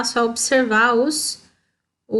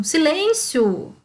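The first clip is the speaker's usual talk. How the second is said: higher, slow silêncio like calling a class to order; stern silence demand; 6 semitones higher, much slower than usual. Shown as a demonstration. Teacher demonstrates an exclaimed command to pupils. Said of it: "Ponto de exclamação".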